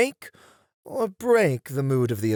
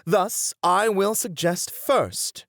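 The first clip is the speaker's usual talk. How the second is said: ordinary talk, nothing marked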